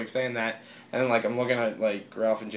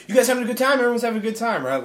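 high, chirping imitation; eager checking-in; 9 semitones higher, much faster than usual. People from The Reckoning checking on everyone, eager high imitation. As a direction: pitch it up like over-keen socializers.

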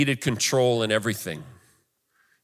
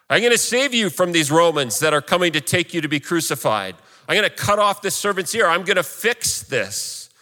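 higher-pitched, determined vow as Peter; lightly voiced rescuer; 6 semitones higher than usual. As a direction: play the eager fixer a little, pitch lifted.

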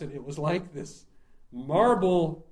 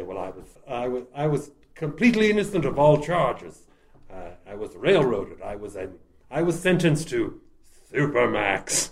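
Boris Karloff; 2 semitones lower, 5 dB louder than usual. Acted. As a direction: protest like a theatrical horror-film doctor. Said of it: "doing a Boris Karloff impression".

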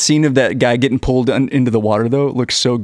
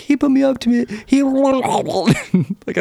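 put-on high-pitched kid getting swamped mid-sentence; high voice breaking into watery garble; kid talking through water; 13 semitones higher, much slower than usual. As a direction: protest as water washes over your mouth, dissolving into gargle. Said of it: "while he's talking, the water, like, goes over his face".

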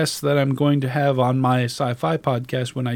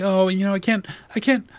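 higher, softening helpless protest; mock self-excusing; 7 semitones higher than usual. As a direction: imitate your own feeble excuse-making. Voices own excuses to himself.

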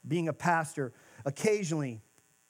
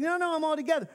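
defensive denial; 10 semitones higher, faster than usual. Pretend. Insisting to friend he's fine.

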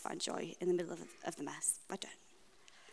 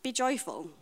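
mock preachy command; 3 semitones higher than usual. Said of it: "People tell me to be joyful".

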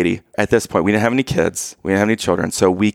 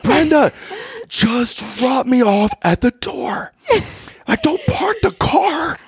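high, strained, desperate plea; frantic yelling; slower than usual. Retold or imitated. Husband begging his wife to drop him at the door, high frantic yell. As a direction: beg the driver with rising panic. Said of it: "losing my mind, yelling at your mom".

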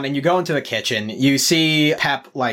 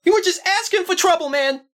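high, mocking, taunting sibling; sneering brother. Brother Pep taunting the returning hero, high sneering voice. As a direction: needle him about being a hero. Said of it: "He goes".

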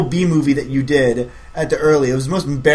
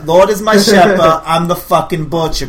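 "Lord is my shepherd" louder and higher, "butcher" driven home slowly; pushing the line harder; 5 semitones higher, 9 dB louder than usual. Pretend. Director repeating the line for the actor, louder and pushier. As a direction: hammer it so the actor gets the menace. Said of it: "Come on, you're the butcher".

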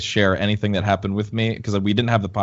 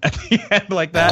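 ordinary talk

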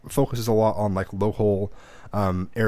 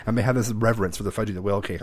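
usual speaking voice

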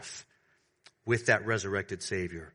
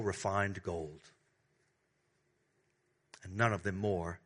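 tender preaching. Acted. Pastor tenderly urging the beloved to remember the prophets. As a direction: draw the congregation in gently. Soft tender sermon passage.

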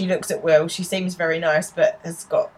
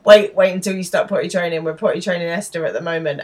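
ordinary talk

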